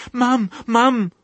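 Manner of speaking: very high, loud piglet cry; terrified shout. A narrator's reading. Piglet waking from nightmare calls mum, terrified. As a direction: cry out for mother in panic. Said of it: "shouted Babe in terror".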